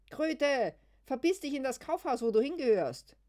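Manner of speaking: very high, very loud screech; mock insult shout. Gespielter Schimpfstreit. (gespieltes schrilles Schimpfen)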